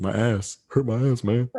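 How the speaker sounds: hammed-up hurting whine; mock whine. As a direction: whine about the bruise. Retold pained complaint.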